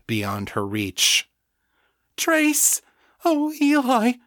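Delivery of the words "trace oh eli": very high, anguished cry; grief-stricken call to the lost; sobbing. A narrator's reading. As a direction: wail the name in grief, high-pitched. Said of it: "she sobbed".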